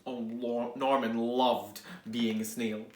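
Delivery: ordinary delivery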